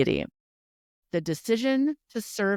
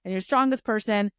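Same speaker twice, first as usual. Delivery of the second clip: exasperated rush; 6 semitones higher, 4 dB louder than usual. Retold or imitated. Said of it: "He's like".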